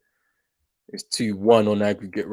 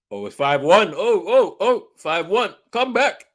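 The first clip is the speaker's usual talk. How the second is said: higher, louder sudden hope; eager little outburst; excited; 6 semitones higher, 7 dB louder, slower than usual. Real, not acted. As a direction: perk up at the goal. Goal sparks comeback hope.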